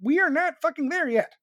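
high, loud scolding; exasperated. Real, not acted. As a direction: let the frustration climb.

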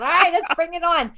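imitating an eager friend, high; hammed-up eagerness to get going; mock eager. Friends eager to share their application, mock excitement. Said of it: "all three of us are sitting on our application going".

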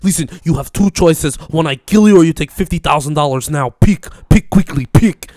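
hammed-up Russian gangster accent pressing for a choice. Prankster threatening hired diggers, in a loud mock Russian accent. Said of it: "talking in, like, a very Russian accent".

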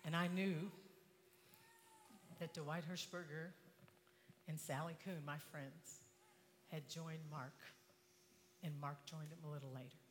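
hushed, low, halting telling; somber. Real, not acted. Speaker recounting the sight of lost friends, somber and hushed. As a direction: tell it softly with long, heavy pauses.

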